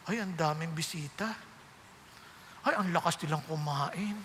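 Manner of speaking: stingy host griping quietly; grumbling host. Retold or imitated. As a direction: grumble under your breath about the guests. Host grumbling about many guests.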